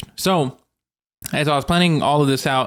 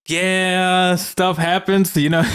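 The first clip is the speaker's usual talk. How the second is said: drawn-out, higher-pitched shrug of an excuse; imitated sheepish excuse; 5 semitones higher, much slower than usual. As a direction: lightly mimic a friend excusing himself. Late friend's excuse, retold.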